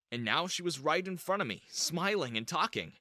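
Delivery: ordinary delivery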